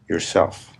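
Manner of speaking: normal talking voice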